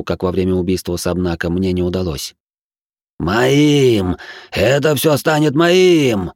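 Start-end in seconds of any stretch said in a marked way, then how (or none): 3.2-6.3 greedy fanatic. Acted. Fanatic craves power.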